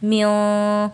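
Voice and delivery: म्यों with the noon hidden in the nose; hidden nasal noon. Shown as a demonstration. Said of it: "नून की आवाज़ को नाक में छुपा के पढ़ेंगे". (म्यों में नून की आवाज़ नाक में छुपाकर)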